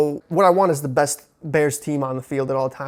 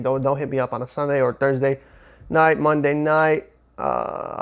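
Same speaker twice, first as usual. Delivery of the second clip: usual speaking voice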